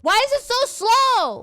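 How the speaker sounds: high, loud, wailing complaint; exasperated yell. Real, not acted. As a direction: wail it in gamer frustration. Frustrated by slow turning in game.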